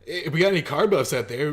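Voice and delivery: lively shout-out, lifted; calling out. Real, not acted. Calls out to car fans watching.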